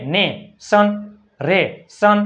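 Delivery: ને and સન split by a clear pause; teacher blending ને, સન in pieces; syllable by syllable. Shown as a demonstration. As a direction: break રે, સન into two separate beats. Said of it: "તો N એ".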